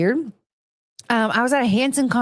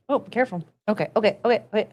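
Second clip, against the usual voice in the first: ordinary delivery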